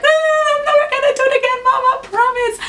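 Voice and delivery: high, fast, tearful pleading wail; retold bawling. Daughter begging her mom through tears, high and fast.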